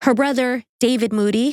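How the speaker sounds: ordinary delivery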